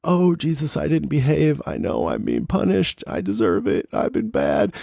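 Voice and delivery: imitating a man begging Jesus; mock crying prayer. Retelling Merle praying to Jesus, mock tearful plea. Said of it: "starts praying to Jesus and crying".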